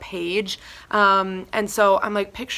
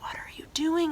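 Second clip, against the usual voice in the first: whispered disbelief; incredulous whisper to the listener; hushed aside; 8 semitones higher, 9 dB quieter than usual. Real, not acted. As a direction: breathe it out in a whisper. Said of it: "unvoiced whisper".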